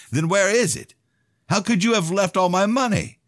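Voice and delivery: enraged demand. A narrator's reading. Son demanding his missing money from his father, enraged. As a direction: demand it as if he can't believe it.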